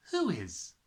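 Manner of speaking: ordinary delivery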